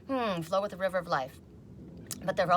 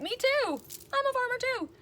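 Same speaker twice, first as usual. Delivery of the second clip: high, slow, put-on voice; playful high echo of the phrase; mock reply; 3 dB louder than usual. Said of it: "And I'm like".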